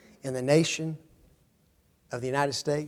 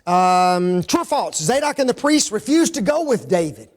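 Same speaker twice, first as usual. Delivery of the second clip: ordinary talk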